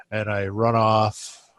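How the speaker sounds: normal talking voice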